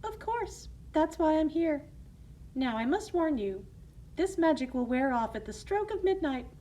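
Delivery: high, sweet motherly voice; kind fairy godmother. Acted. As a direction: reassure her gently.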